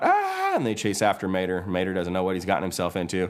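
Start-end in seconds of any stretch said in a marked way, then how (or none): 0.0-0.6 high, loud mock scream; freak-out scream. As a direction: shriek in cartoon panic. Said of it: "Mater's freaking out".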